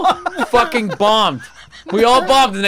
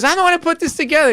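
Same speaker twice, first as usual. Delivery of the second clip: bossy organizer lady; 11 semitones higher, faster than usual. Retold or imitated. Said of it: "she goes".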